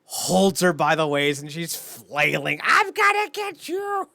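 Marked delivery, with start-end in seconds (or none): 2.6-4.1 high, slow, cartoonish little threat; squeaky mock threat. Imitating Alicia's squeaky threat while flailing. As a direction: play a pint-sized fighter vowing revenge. Imitating tiny angry wrestler.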